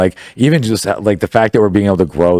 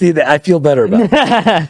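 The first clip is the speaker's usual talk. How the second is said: laughing relief; 9 semitones higher than usual. Real, not acted. Relieved joke after mock insult.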